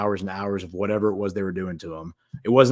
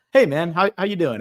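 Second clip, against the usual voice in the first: high, loud, chummy greeting; mock-friendly host welcoming his captive; super nice; 9 semitones higher, 7 dB louder than usual. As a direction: lay on the fake charm, big and bright. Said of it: "he's, like, super nice to him".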